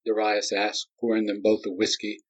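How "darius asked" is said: ordinary delivery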